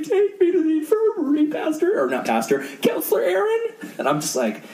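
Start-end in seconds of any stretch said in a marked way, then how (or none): 0.0-2.0 very high, wailing kid impression; mock bawling. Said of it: "He starts bawling". 2.8-3.6 high, whiny imitation of a crying boy; mock bawling. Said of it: "He starts bawling".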